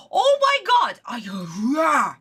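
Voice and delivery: so pissed it ends in a wordless growl; exasperated rage. Real, not acted. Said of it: "I am so pissed".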